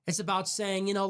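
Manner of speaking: normal talking voice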